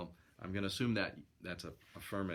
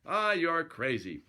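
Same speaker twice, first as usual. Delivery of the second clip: raised, drawn-out irritated retort; a touch of exasperation, slow and higher; snapped; 7 semitones higher, 5 dB louder, slower than usual. Acted. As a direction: brush him off with mild annoyance. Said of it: "I snapped".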